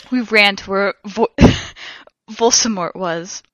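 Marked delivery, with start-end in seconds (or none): none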